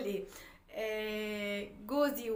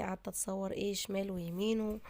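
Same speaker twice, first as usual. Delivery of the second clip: ordinary delivery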